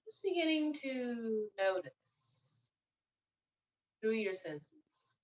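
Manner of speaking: soft, unhurried meditation guide; guided meditation. Acted. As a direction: ease them gently into stillness, leaving long pauses. Leads listeners into meditation.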